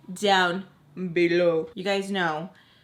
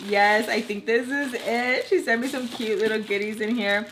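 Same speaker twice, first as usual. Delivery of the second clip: ordinary delivery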